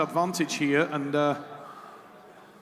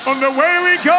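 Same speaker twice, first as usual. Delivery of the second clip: much higher, louder live call; excited shout; 10 semitones higher, 8 dB louder than usual. Real, not acted. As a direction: erupt as the match begins. Match starts, commentator erupts.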